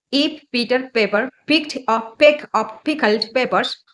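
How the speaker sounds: ordinary talk, nothing marked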